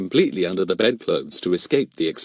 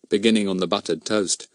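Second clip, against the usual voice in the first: ordinary delivery, nothing marked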